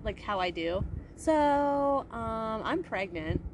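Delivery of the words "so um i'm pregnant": nonchalant. Retold or imitated. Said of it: "I just said it, like, nonchalantly".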